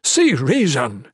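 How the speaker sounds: slow, loud appeal; drawn-out, insistent plea; pleading. A narrator's reading. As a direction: plead with him urgently, stretching the words. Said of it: "Ethris pleaded".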